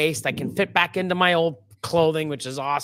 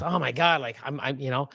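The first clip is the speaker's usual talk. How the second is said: ordinary talk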